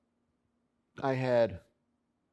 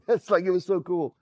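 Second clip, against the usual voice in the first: laughs through it, higher and louder; laughing; 8 semitones higher, 6 dB louder than usual. Real, not acted. Narrator laughing to the listener at Johnny's joke, high and loud. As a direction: still cracking up at the mud joke.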